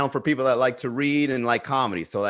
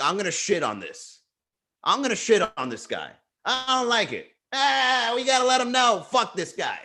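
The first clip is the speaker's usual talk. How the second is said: imitating a petty troll, rising higher as he gets worked up; sneering troll voice, pitched up for the middle lines; mock internet troll; 8 semitones higher than usual. Mocking the guys who trash his ads, sneering troll. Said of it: "they're just like".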